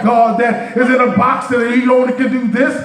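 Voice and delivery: full-force preaching. Acted. Preacher lifting the church, full-force preaching. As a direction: preach it out to the congregation, rising and ringing.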